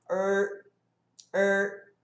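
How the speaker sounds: er held with the tongue curled back; English R. Shown as a demonstration. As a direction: sustain er as a retroflex R. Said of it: "a língua dobra". (er com R inglês, língua dobrada)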